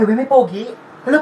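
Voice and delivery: ordinary talk, nothing marked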